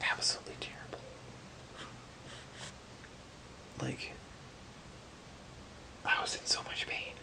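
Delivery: breathy hushed whisper; barely voiced, close to the mic. Real, not acted. Whispering about painful hospital stay.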